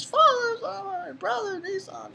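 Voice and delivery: very high, imitated wailing girl; put-on high tearful plea; mock tearful cry. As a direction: imitate a distraught daughter calling out in falsetto. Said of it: "she's all really, her tears are coming out".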